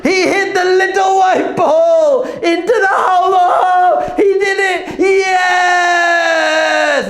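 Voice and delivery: screaming high in triumph; long, high victory yells; wild celebration. Acted. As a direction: erupt like a stadium gone mad. Acts out golf crowd erupting.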